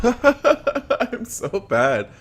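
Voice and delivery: high, loud words through laughter; cracking up at own failure; laughing. Real, not acted. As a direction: laugh helplessly while admitting it. Laughs at failing in game.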